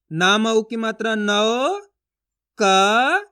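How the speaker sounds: slow chant, नौ and क stretched on rising glides; syllable spelling drill. Shown as a demonstration. Teacher modelling reading for learners, drawn-out and rising. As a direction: spell it out, holding each sound apart. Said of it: "उसी तरीके से आपको भी पढ़ना है".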